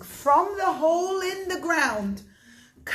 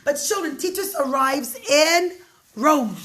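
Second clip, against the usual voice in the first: plain reading voice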